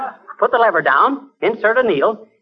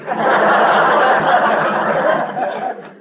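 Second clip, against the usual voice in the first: laughing; 4 semitones higher than usual. Acted. [name] laughs at his own joke.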